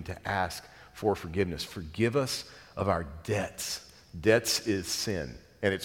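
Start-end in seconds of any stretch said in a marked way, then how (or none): 1.6-3.9 slow, reverent prayer quote; quoting the Lord's Prayer, slow and reverent; prayer line. Shown as a demonstration. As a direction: pray it slowly. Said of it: "part of our daily prayer".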